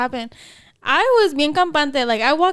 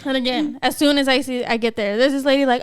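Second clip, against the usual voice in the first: normal talking voice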